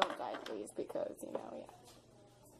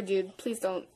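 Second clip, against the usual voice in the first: ordinary talk, nothing marked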